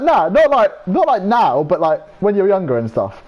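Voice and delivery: high, loud, whiny protest, slowing down; squeaky defensive friend. Retold or imitated. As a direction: play the friend backpedalling in a squeaky voice. Retold defensive friend's reply.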